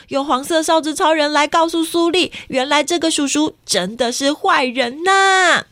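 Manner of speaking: ordinary delivery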